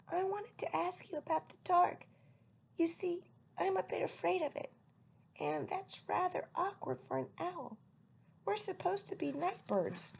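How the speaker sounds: high shy owlet voice; small owl confessing fear, pitched high. A narrator's reading. Owlet telling old lady his fear, high.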